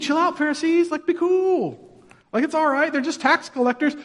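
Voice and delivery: high, airy mocking tone. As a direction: brush them off in a high, casual voice. Mocking the Pharisees, voiced reply.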